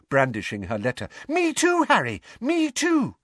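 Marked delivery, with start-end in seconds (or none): 1.3-3.1 very high, thrilled squeal; excited. A narrator's reading. Hermione brandishing her letter at Harry, excited. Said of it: "she said excitedly".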